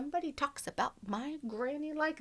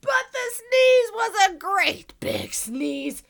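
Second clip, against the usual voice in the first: slow, loud wind-up to a sneeze; building sneeze; 8 semitones higher, 8 dB louder, slower than usual. Acted. Wolf winding up a huge sneeze, slow and loud.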